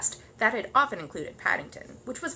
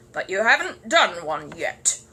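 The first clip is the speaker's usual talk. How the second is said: slow, louder grumble of a cross neighbour; grumbled; 8 dB louder, slower than usual. Acted. Neighbour heckling the bear's magic show, grumbling. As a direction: grumble it sourly, slowly. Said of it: "grumbled Mister Curry".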